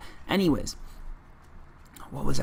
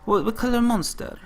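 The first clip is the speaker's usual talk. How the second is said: high, clumsy stab at French English; bad French accent; 9 semitones higher than usual. French vendor puzzled by a Monster order, bad French accent. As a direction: try a French accent, pitched well up. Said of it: "I'm not doing it right at all".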